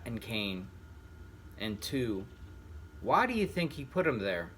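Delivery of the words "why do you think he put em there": usual speaking voice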